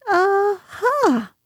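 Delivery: very high, small voice; anxious Piglet. A narrator's reading. Piglet looking round for the others, anxious. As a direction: squeak it out, pitched right up. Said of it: "looking round anxiously for the others".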